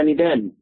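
"ندال" as "nidal" with a light, thin d; wrong light dal. Shown as a demonstration. Teacher lists what not to say, light dal. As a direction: say "ندال" without any emphasis on the d. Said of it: "مش هتقول".

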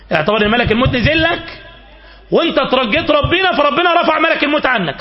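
loud, impassioned preacher; forceful preaching. Real, not acted. Preacher urging listeners to imagine a reprieve from death, forceful. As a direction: preach it out at full volume.

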